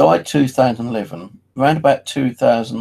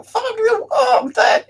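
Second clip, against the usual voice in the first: much higher, loud hammed-up sobbing yell; mock crying shout; 4 dB louder, slower than usual. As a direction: wail at the ghost of Dad, half laughing. Imagines seeing his dead dad.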